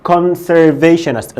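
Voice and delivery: Conservationist said slowly and clearly; slow modelling. Shown as a demonstration. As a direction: stretch Conservationist for the class. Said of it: "بقى اسمه إيه؟".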